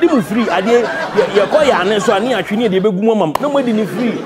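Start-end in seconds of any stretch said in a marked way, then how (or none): none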